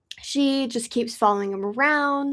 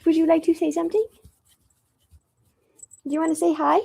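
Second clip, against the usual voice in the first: higher pet-talk voice; talking to the cat; 5 semitones higher, much faster than usual. Real, not acted. Asking her cat to greet viewers in pet talk. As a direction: gently coax the cat to speak.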